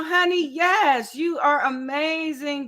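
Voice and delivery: ordinary talk, nothing marked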